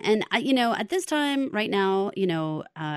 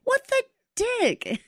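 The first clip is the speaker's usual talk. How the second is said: exaggerated catchphrase. Shown as a demonstration. Co-host showing off own catchphrase, hammed-up and high. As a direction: squeal out your own signature catchphrase. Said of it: "that's my catchphrase".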